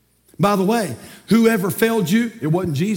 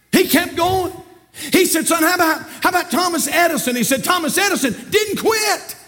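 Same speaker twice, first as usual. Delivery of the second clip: urgent Southern preacher, building higher and higher; full-force preaching; 9 semitones higher than usual. Acted. Preacher acts father urging son not to quit, rousing. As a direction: drive it home like a revival preacher.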